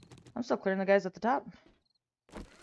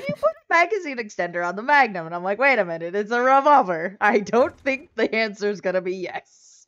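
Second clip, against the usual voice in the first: loud, animated self-defence; loud protest; 8 dB louder than usual. Real, not acted. Defending a silly thought to a friend, loud and animated. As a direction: protest emphatically, raising your voice over the objection. Defending a misread remark, loudly.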